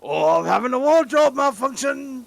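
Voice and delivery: a quoted game character line. Retold or imitated.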